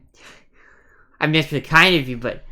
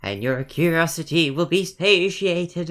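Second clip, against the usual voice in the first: ghost voice; 3 semitones higher, slower than usual. Acted. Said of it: "I don't sound like a ghost, do I?".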